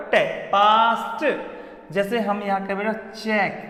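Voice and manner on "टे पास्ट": टे, पास्ट drawn out slowly, stressing the t; slow pronunciation drill. Shown as a demonstration. Teacher drills students slowly on the t in पास्ट. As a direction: spell the sound out slowly: टे, then पास्ट. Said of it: "क्या बोलता है बेटा".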